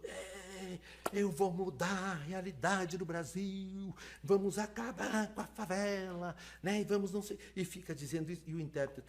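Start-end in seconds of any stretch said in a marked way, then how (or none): 0.0-7.4 higher, slow, grandstanding campaign voice; mock politician. Lecturer mocking a politician promising to change Brazil, slow and pompous. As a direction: ham up a politician's empty promises. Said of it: "Tá lá o político, né? Que é todo".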